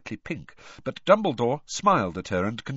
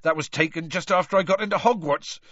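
quick, croaky, higher and louder; 8 semitones higher, 5 dB louder, faster than usual. A narrator's reading. Gamekeeper explaining an old photo, croaky. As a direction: croak out the memory.

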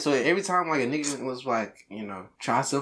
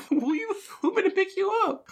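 high mock wife. Acting out wife answering.

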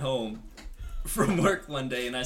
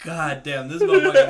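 exclaiming; 3 semitones higher, 12 dB louder than usual. Retold or imitated. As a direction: blurt it out in disbelief, laughing. Exclaiming at friend's remark.

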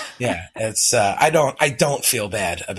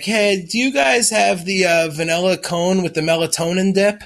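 mock innocent request; 6 semitones higher than usual. Tired dad mock-asking the ice cream man, sweet and innocent. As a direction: ask sweetly for a melatonin dip.

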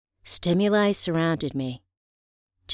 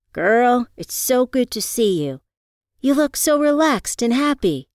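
bright, high friendly greeting; Riva's voice; 7 semitones higher, 5 dB louder than usual. Acted. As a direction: greet an old friend with delight. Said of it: "Riva said".

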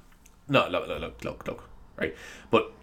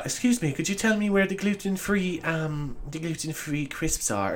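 soft, high put-on posh accent; polite customer in a fake posh accent; 4 semitones higher, 7 dB quieter, slower than usual. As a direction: mimic an affected posh shopper. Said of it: "those people with the fake accents".

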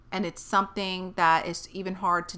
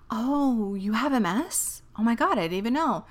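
imitating a nurse's sudden sympathy, a bit higher; lightly voiced gushing nurse; mock shocked nurse; 3 semitones higher than usual. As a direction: play the startled, pitying nurse. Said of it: "the whole tone changes".

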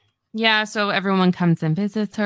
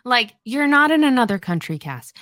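exasperated scolding; 5 semitones higher, 4 dB louder than usual. Retold or imitated. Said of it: "I kept being like".

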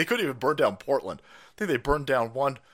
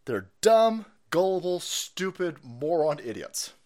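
normal talking voice

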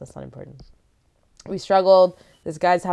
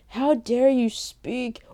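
slow, higher mock outrage; outraged churchgoer; 6 semitones higher, much slower than usual. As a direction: imitate a scandalized old churchgoer, drawn out. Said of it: "that are like".

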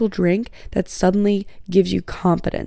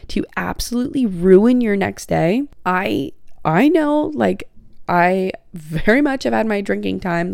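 usual speaking voice

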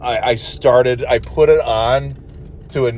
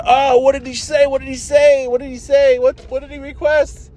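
much higher, frantic repeated question; frantic squeaky self; 13 semitones higher, 3 dB louder than usual. Retold or imitated. Driver missing what the kid said, squeaky frantic. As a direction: squeak it out in disbelief. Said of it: "And I said".

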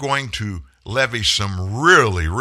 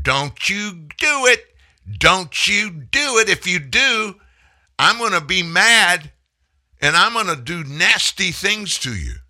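mock holler; 9 semitones higher, 6 dB louder than usual. Mocking Biden hollering at Putin across fence, hammed-up. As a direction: ham up a feeble schoolyard threat. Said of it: "he hollered".

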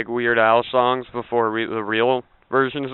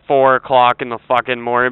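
ordinary talk

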